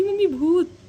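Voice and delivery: high, loud frightened shout; scared scream. Retold or imitated. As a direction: shriek for mom in fright. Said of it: "Riddhi एकदम चिल्ला के उठना". (डर के मारे ज़ोर से चीख)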